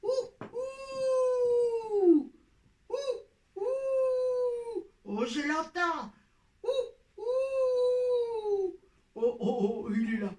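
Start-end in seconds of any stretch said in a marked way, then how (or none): 0.0-4.8 owl hooting. Acted. Puppet owl hooting offstage. 6.6-8.8 owl hooting. Acted. Puppet owl hooting offstage.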